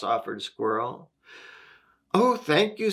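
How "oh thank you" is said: high-pitched, gushing storybook gratitude; delighted thanks. A narrator's reading. Rabbit to Squirrel offering a place to sleep, delighted thanks.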